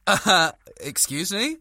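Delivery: indignant excuse me; affronted, drawn out; mock offended. Offended at insult to Welsh.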